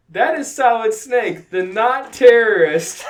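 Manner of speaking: loud, high acted line breaking into laughter; cracking up. Amy introducing Snake to Sailor Moon, cracking up. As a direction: proclaim it loudly, losing it at the end.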